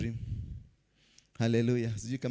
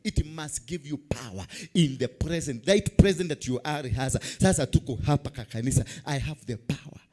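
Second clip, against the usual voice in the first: ordinary talk, nothing marked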